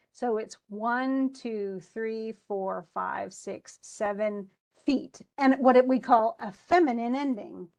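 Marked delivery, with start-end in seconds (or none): none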